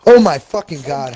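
loud angry swearing; angry outburst. Real, not acted. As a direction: yell it in frustration. Teammate shot unexpectedly.

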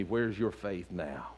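low, soft, slow devil voice; taunting devil. Retold or imitated. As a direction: sneer it quietly like the devil tempting. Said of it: "He said to me".

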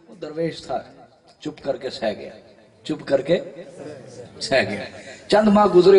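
usual speaking voice

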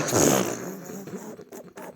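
low, drawn-out chuckle; deep laugh. Real, not acted. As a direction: let out a long low laugh. Laughs after the close call.

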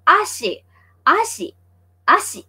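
"Ashi" said alone, as a separate word each time; model pronunciation. Shown as a demonstration. Teacher has viewers repeat "Ashi" after her, modelling it. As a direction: repeat "Ashi" for learners to echo, pausing between. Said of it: "Can you say it with me".

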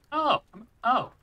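sudden high yelp of surprise; startled. Real, not acted. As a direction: jump at the shock, voice leaps up high. Startled by something in game.

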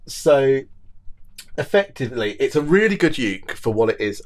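ordinary talk

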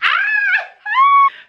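very high, loud cringing squeal; cringe shriek. Real, not acted. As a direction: squeal in secondhand embarrassment. Cringing at a painful joke.

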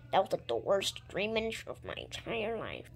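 ordinary talk, nothing marked

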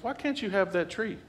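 snake voice. Retold or imitated. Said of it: "He starts whispering in the ear".

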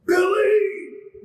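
furious cry at Billy; angry shout. Pretend. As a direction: burst out calling Billy. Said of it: "making me jump up and wanna go".

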